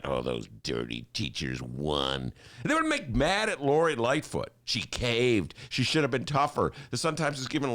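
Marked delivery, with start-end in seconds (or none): 0.0-2.3 mock sneer. Mocking editorials' theme.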